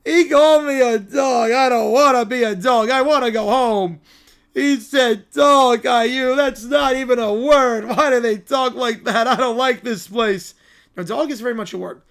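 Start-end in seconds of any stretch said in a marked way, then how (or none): 0.0-10.5 slow, loud wailing whine; whining child. Acted. Child offended at being called a dog, loud whining. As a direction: stretch the words out in a sulky bawl. Voices a child upset by vendor.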